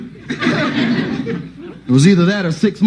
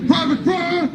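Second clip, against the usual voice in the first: sergeant calling; 11 semitones higher, 3 dB quieter, slower than usual. Retold or imitated. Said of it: "the sergeant would be bad".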